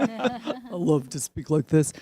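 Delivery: slow, half-laughing deep voice; deep male voice. Shown as a demonstration. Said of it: "I can sing in a deep voice".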